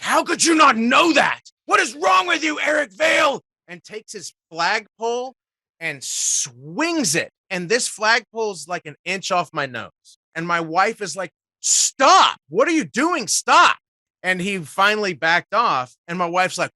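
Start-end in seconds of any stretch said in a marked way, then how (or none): 0.0-3.4 high, loud furious shout; irritated shout. Retold or imitated. Irritated fan shouting at Eric Vale over a trivia question, high angry shout. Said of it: "got visibly irritated and was like". 11.6-13.8 high, loud sharp shouts of stop; alarmed shout. Retold or imitated. Wife shouting at the flagpole-swinging fan, loud and high.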